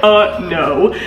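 ordinary delivery

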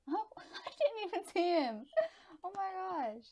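high, loud shock with a laugh; real, laughing high-pitched dismay; startled outcry. Real, not acted. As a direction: yelp the words in surprise. Caught unawares in the game.

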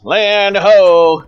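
high, loud crow's-nest call; long, ringing sailor's holler; lookout's yell. Acted. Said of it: "and yells".

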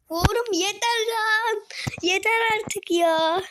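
real frustration at the game spilling over, slow; slow, exasperated groan of "enough already"; exasperated outburst. Real, not acted. Fed up after dying again.

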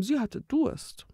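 ordinary delivery

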